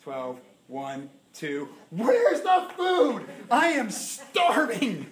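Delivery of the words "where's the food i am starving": high, loud and very slow, laughing through it; hammed-up starving wail. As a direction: stretch it out like a comically famished man. Retold hunger at the party.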